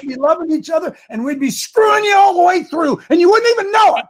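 high, loud sneering impression; mock the enemies, building to a shout; sarcastic shout. Ranting preacher mocking opponents building to a loud shout.

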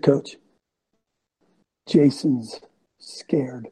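slow, secretive whisper; quiet whisper. Retold or imitated. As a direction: whisper the warning slowly to the coach. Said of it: "came over to me real quietly".

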